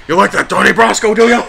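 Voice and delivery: loud laughing Pacino voice; Al Pacino impression. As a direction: growl it like a mob movie. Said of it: "Is that Al Pacino?".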